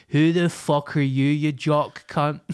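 loud, indignant mock English accent. Ricky Gervais fans pile on the comedian, mock English outrage. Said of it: "all these Ricky Gervais fans".

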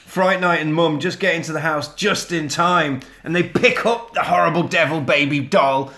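ordinary talk, nothing marked

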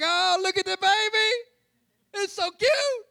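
slow, high cooing; thug's voice goes high cooing at a baby; high baby talk. Retold or imitated. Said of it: "His voice will go up for it".

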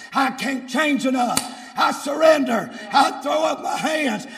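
driving sermon rising to a shout; full-force preaching. Real, not acted. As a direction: press the congregation hard to surrender.

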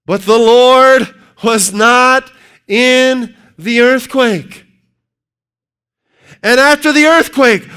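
high, loud, slow scripture reading; preaching scripture. Acted. Preacher reading First Kings aloud at full force. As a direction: thunder out the verse.

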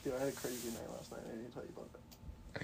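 hushed secret whisper. Real, not acted. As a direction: lean in and whisper the news. Secret news whispered to friend.

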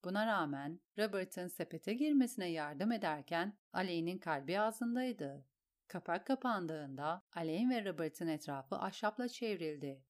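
ordinary delivery, nothing marked

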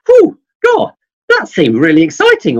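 high, loud burst of mock excitement; excited exclaim. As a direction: gush as if the activity thrilled you. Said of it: "you might wanna say".

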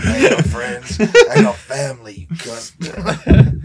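can barely get it out for laughing; laughing mock quote; laughing through the quote.

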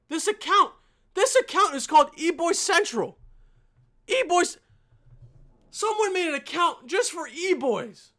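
exasperated high rant; angry rant. Real, not acted. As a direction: rage at the account name. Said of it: "I don't know why I'm getting so angry".

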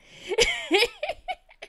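loud high burst of laughter; delighted high laugh mid-story. Real, not acted. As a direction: crack up high. Big laugh.

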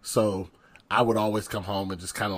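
ordinary talk, nothing marked

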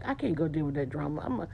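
a mumbled brush-off; mock lazy excuse. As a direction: grumble it like you're dodging the call. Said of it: "They're like".

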